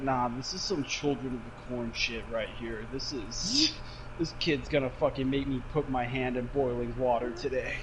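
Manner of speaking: low, soft aside to himself; deadpan mutter of resignation; muttered dread. Retold or imitated. Acts his own wary inner reaction.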